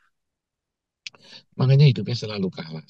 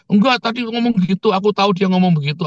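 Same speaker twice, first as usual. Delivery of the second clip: high, hurried protest; mock insistence; 7 semitones higher, faster than usual. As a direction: imitate someone sure of what they heard. Acted accuser insisting she heard it. (menirukan bantahan ngotot bernada tinggi)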